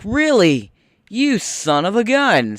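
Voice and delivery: high, slow, hammed-up fed-up cry; mock exasperation. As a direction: curse the vending machine in mock outrage. Machine spits the dollar out again.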